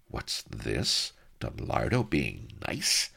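plain reading voice

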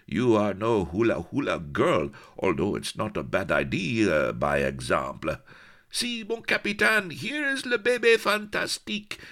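excited French pirate showing off a captive; bawling Breton. A narrator's reading. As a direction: crow in Franglais with a thick French accent. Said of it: "bawled a burly Breton".

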